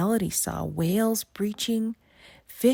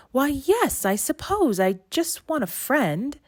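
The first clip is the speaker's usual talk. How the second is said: put-on higher, brighter girlish voice; timid young mermaid; 9 semitones higher, 4 dB louder than usual. A narrator's reading. Melody answering the sea witch, hopeful and higher.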